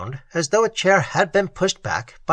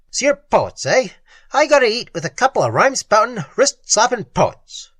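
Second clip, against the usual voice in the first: higher and louder, fast sneer that slows at the end; sneering thug; 8 semitones higher, 4 dB louder than usual. Acted. Thug scoffing at his table mates, loud sneer.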